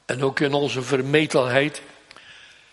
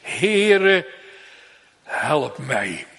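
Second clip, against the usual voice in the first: calling plea; much slower than usual. Acted. Mother's heart calling to Jesus, mildly pleading. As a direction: call out then sink into begging. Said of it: "dat roept tot Jezus".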